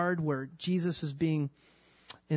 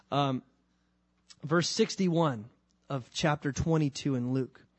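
ordinary talk, nothing marked

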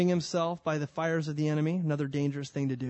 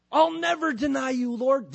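eager vow; 9 semitones higher, 4 dB louder than usual. Acted. Peter's boast voiced.

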